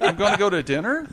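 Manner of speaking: "dinner" lifted high like a question, amused; upspeak. Shown as a demonstration. Friend repeating the Canadian joke, laughing upspeak. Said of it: "sentences that sound like questions".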